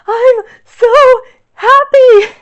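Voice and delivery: high, loud tearful sobbing; happy crying. Retold or imitated. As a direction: say it through overwhelmed tears of joy. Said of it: "I was hysterically crying".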